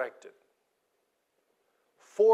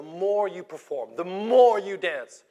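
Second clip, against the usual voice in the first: rising preacher's shout; 4 semitones higher, 9 dB louder than usual. Real, not acted. Preacher builds up the list.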